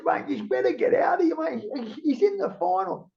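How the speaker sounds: retold urgent call; urgent excitement. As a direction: hurry him along, worked up. Urging Borg on the phone.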